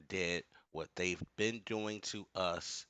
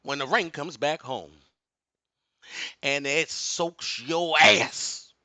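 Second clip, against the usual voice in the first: booming motivational speech; 7 semitones higher, 9 dB louder than usual. Real, not acted. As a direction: thunder it like a revival preacher. Sermon-like warning, asks for amen.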